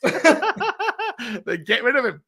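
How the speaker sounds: very slow, laughing through it; laughing advice. Real, not acted. Co-host laughing at the fan's rant. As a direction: chuckle it out.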